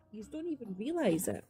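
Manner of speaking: a soft, pointed repeat; quiet emphasis. Real, not acted. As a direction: drop the volume and let "Yous don't even realise it" land quietly.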